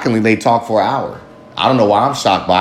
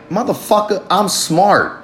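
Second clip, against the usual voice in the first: higher, offended snap back at mom; a touch of wounded pride, pitched up; indignant retort; 8 semitones higher, slower than usual. Retold or imitated. Upset inner retort to mom.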